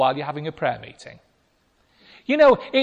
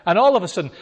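ordinary talk, nothing marked

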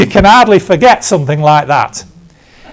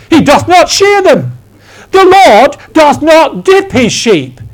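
booming prophet; 6 semitones higher than usual. Retold or imitated. Man prophesying from the gallery at a youth rally, booming prophet. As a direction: proclaim it high and insistently, over and over. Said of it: "someone stood up and began to prophesy".

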